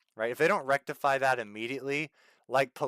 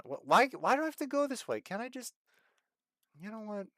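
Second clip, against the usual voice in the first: higher, whining in frustration; exasperated whine; 8 semitones higher than usual. Real, not acted. Streamer annoyed at having to drive around, whiny. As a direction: complain at the detour.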